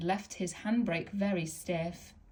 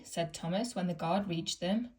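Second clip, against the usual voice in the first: usual speaking voice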